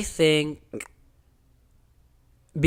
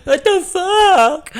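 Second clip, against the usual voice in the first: high, slow, louder crying wail; mock sobbing; 12 semitones higher, 6 dB louder, much slower than usual. Imitating herself crying in the hotel room, slow high sob. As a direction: wail it like you're sobbing again. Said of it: "I'm like".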